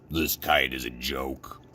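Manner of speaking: a glum storybook Toad; discouraged Toad. A narrator's reading. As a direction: give up with a sigh in the voice.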